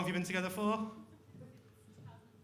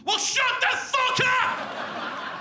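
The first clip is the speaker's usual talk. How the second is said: high, loud and slow, hammed-up rage; hammed-up shout at a snoring girlfriend; mock furious yell; 10 semitones higher, 4 dB louder, slower than usual. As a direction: bellow it at a snoring partner. Irritated partner yelling in bed.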